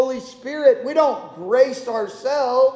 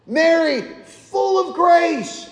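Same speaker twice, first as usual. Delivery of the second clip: high, slow, ringing liturgical chant; prayer intonation; 6 semitones higher, slower than usual. Pretend. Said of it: "sounds like an idolatrous prayer".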